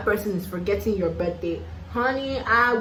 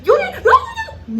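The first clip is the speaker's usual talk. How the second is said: mock confrontation; 7 dB louder than usual. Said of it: "I don't know how to be like".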